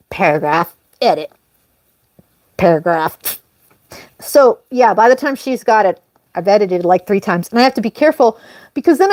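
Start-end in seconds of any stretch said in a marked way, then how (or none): 0.1-3.2 droning mock robot. Mocking her own editing routine.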